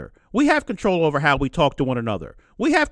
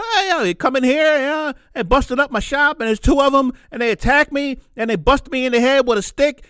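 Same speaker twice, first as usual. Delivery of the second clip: angry shopkeeper; 7 semitones higher than usual. Retold or imitated. Shop owner telling the news how they wrecked his store, furious. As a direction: play the shop owner venting about the attack. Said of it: "He's pissed".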